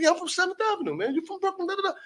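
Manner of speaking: very high-pitched eager magician; Chris Angel. Retold or imitated. Magician inviting him onto his TV show, high and eager. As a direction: imitate an eager stranger, pitch way up.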